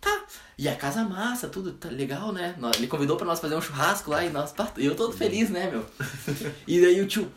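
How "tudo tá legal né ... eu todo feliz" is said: usual speaking voice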